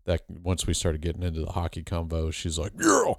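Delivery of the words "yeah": high, loud squealed yell; mock shout. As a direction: scream it like a crazed hockey fan. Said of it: "She didn't talk like that".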